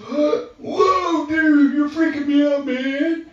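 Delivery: high, loud astonished cry; a young man losing it in shock; freaked-out cry. Retold or imitated. Client reacting to the sword vision, high loud astonished cry. Man retold seeing demon destroyed.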